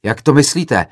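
loud, high, worked-up retort; agitated yell. A narrator's reading. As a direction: demand it angrily from the audience. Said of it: "zařval rozčileně jiný student".

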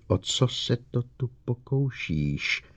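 plain reading voice

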